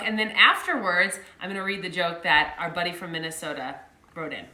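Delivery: ordinary delivery, nothing marked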